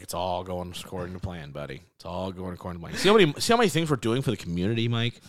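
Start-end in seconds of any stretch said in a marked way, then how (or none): 0.0-3.0 low, soft scheming mock reassurance; sinister quiet owner voice. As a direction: purr it like a villain. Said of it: "Tom Gores is like".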